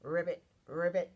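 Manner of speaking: loud ribbit with rolled start; punchy frog imitation; frog croak. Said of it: "Can you make that sound".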